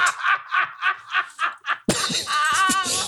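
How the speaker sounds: long high cackle of laughter; gleeful cackle. Real, not acted. Laughs at Super Bowl memory.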